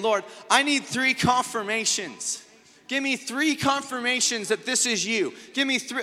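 demanding believer. Retold or imitated. Young believer demanding signs from God, pleading and insistent. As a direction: beg God for proof like a stubborn disciple.

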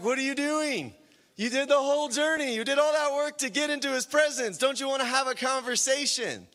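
high, pressing disbelief; incredulous reproach. Acted. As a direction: act out baffled exasperation, pitched well up. Acted reproach of a turned-back guest.

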